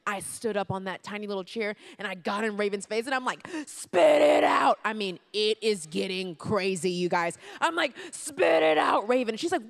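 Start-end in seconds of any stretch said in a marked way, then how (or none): 3.7-4.8 very loud, high, drawn-out retold shout; yelled order. Retold cafeteria confrontation, yelling. 8.1-9.4 loud, high mock-furious shout; yelled order. Retold cafeteria confrontation, yelling.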